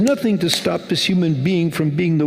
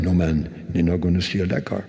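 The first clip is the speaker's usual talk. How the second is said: a hint of a Trinidadian accent; 7 semitones lower than usual. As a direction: mimic the store owner's Trinidadian reply. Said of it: "their own kind of interesting accent".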